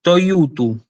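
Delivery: "toyutu" said as one connected, careful word; demonstrated reading. Shown as a demonstration. Teacher repeating how the Greek word is read, careful pronunciation. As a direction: model "toyutu" clearly as a whole word. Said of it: "se lee".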